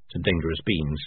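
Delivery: ordinary delivery, nothing marked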